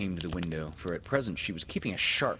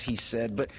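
ordinary delivery

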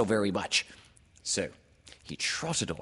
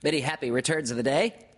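high, loud, hurried little-creature voice; eager piping well-wishing; Piglet; 8 semitones higher, 6 dB louder, faster than usual. A narrator's reading. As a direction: squeak it out quickly and loudly. Piglet repeats the greeting.

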